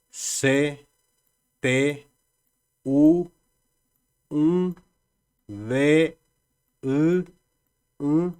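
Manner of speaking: teacher slowly sounding out each letter; alphabet letters read aloud. Shown as a demonstration. Teacher to students, reading the alphabet slowly. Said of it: "Vamos a ver entonces".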